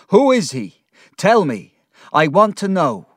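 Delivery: loud, high, furious shouting; angry demand. A narrator's reading. Mister Peggotty demands answers from Ham, furious. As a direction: fire off demands in a rage. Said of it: "he was very angry".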